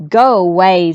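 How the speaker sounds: loud, low shooing snap; angry goose chasing the duckling away; mean goose. Acted. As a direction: shove him off, loud and low. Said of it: "said the goose".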